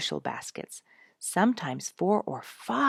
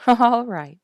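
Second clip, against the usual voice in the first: loud, high laughing reply; playful laugh joining the child's game; 8 semitones higher, 14 dB louder than usual. A narrator's reading. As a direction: laugh the words out. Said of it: "Jenny laughed".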